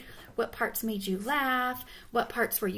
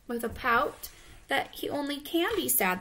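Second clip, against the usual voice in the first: ordinary delivery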